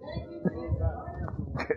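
breathy mock laugh. Mock laugh before quote.

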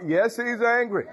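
rising revival fervor; preaching climax. Acted. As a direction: drive it home to the church.

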